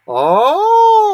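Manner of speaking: a loud, high, shrill-free "oh" of fake shock; loud mock surprise. Real, not acted. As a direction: gasp it out big and high. Reacting to victim's statement.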